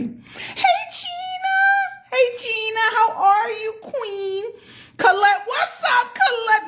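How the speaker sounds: high, joyful calls to each viewer by name; excited shout-out. Real, not acted.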